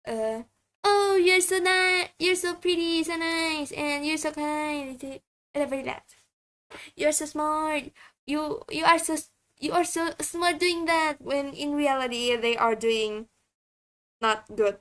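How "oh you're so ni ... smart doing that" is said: sarcastic praise. Retold or imitated. Streamer shows how they tease irritating people, sarcastic mock praise. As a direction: gush over them with mock sweetness. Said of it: "so I would be like".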